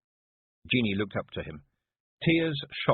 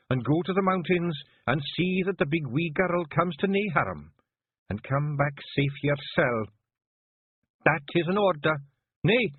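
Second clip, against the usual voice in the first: higher, stern Scots order; commanding Kelder; 9 semitones higher, 3 dB louder than usual. A narrator's reading. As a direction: lay down the law to her chief. Jeanie giving a Kelder's order.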